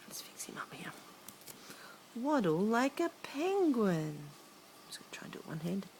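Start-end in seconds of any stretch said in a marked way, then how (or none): 0.1-0.9 soft unvoiced aside; whispered aside. Real, not acted. Aside while handling book. 4.2-5.9 quick soft unvoiced aside; fast whisper. Real, not acted.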